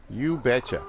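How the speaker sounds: bright Northern Midwest "You betcha." with a quick falling finish; friendly Minnesota-style Northern Midwest "You betcha."; a light Northern Midwest relative saying "You betcha."; Northern Midwest accent. Retold or imitated. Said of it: "I'll do my best impression".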